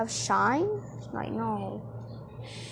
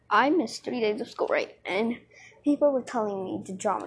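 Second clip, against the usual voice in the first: ordinary delivery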